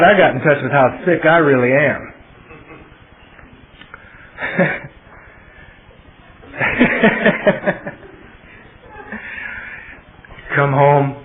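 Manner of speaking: normal talking voice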